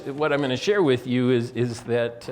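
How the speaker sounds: ordinary delivery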